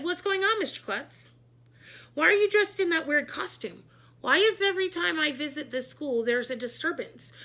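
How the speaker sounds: high, loud, demanding; exasperated questions. A narrator's reading. School board president questioning the principal, high and exasperated. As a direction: fire off questions in alarm.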